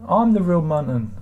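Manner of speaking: a hint of a grand declaration; lightly voiced mock claim to be genuine; mock proclamation. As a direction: announce yourself as the real one. Answering spoofer accounts in chat.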